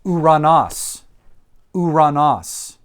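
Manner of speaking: "uranos" said oo-rah-NAHS; "uranos" as a separate, falling citation word; Greek vocabulary demonstration. Shown as a demonstration. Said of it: "here's our next word".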